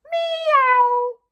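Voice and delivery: long, loud, high-pitched meow; chicken's silly meow. Pretend. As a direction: belt out a high, drawn-out cat noise. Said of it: "The chicken that says".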